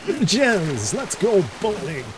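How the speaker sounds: high, hammed-up Eastern European accent; Roman Bellic. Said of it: "my Eastern European accent".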